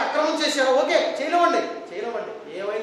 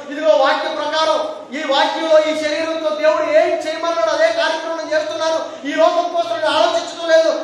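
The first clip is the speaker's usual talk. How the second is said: loud, raised preaching voice; Jesus, preached forcefully; 7 semitones higher, 10 dB louder than usual. Acted. Jesus telling the weeping women not to cry, forceful preaching. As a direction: declaim it high and insistent to the crowd. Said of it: "అప్పుడు యేసుక్రీస్తు ఏమన్నాడు తెలుసండి?".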